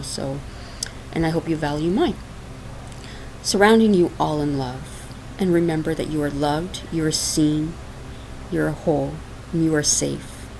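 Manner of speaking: ordinary talk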